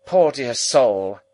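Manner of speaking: high, loud, drawn-out pitying exclamation; brusque, loud show of pity; abrupt lady. A narrator's reading. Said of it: "with an abruptness of manner".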